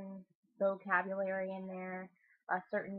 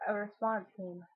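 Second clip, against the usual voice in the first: ordinary delivery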